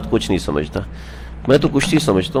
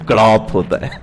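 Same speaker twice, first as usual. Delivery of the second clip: chuckling as he gives the silly answer; "گڑاپ ہوتا ہے" said with a laugh in the voice; laughing through it; 6 dB quieter than usual. Retold or imitated. Preacher mimicking a student's answer to the class, laughing.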